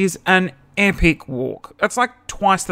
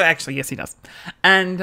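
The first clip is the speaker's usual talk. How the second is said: ordinary delivery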